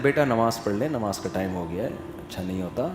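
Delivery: soft, low fatherly coaxing; gentle father. Retold or imitated. Father gently urging son to pray, soft and low. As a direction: coax the son kindly and quietly. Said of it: "باپ بیٹے کو سمجھاتا ہے".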